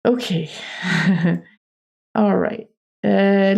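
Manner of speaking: ordinary talk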